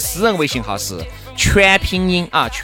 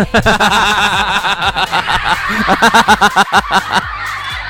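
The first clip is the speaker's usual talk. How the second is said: long burst of laughter. Real, not acted.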